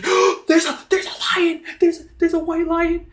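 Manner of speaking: high, loud, frantic cry of alarm; panicked guard. Acted. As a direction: raise the alarm in a hammed-up panic. Startled guard sees a lion.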